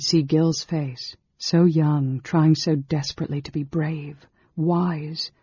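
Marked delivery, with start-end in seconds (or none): none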